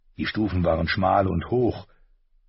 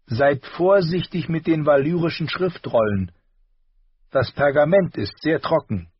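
raised voice for the dwarf lord, brisk and polite; a higher character voice giving orders kindly; Tyrion instructing; 10 semitones higher, 4 dB louder than usual. A narrator's reading. Tyrion addresses the septon.

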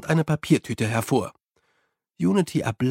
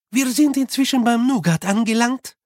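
higher, lively character voice; animated Unity; 9 semitones higher, 4 dB louder than usual. A narrator's reading. Unity reports on the chocolates left, animated. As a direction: voice a keen, animated companion.

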